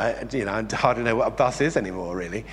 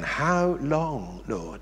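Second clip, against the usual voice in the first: hushed lament; 5 dB quieter, much slower than usual. Acted. Preacher voicing lament cry, hushed.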